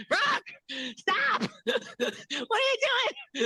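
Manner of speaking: very high, squealed mock protest broken by laughs; mock scolding imitation. Acting out the birthday video, high laughing imitation. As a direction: put on a silly high voice, giggling through it. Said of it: "That's a good imitation".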